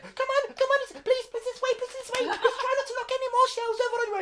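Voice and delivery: very high, loud squeaky pixie voice; tiny pixie. Acted. Pixie guiding the giant out, very high and squeaky. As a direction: chirp it like a buzzing little fairy. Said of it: "He said".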